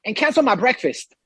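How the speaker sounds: a hint of a rushed customer; imitated hurried guest. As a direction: imitate an impatient guest checking out. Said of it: "this Chinese guy goes".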